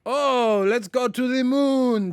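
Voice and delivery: put-on high, loud, slow Russian accent. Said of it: "Russian accent was good".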